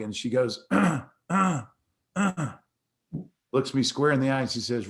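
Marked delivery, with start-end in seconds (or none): 0.7-2.5 higher, louder mock throat clear; imitating a choking clear; throat-clearing frog. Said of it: "kinda had a little frog".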